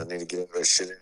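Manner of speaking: hushed aside whisper. Real, not acted. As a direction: whisper it to yourself.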